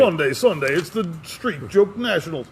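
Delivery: a lightly voiced monster-truck promo hype; mock-hype it like a Sunday race ad; drag race announcer. Imitating the promoter's drag race ad, lightly hyped. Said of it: "like a drag race".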